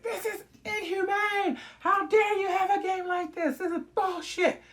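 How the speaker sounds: very high, slow indignant protest; high mock indignant yell; hammed-up outrage. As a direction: protest like a furious player. Player protesting the game.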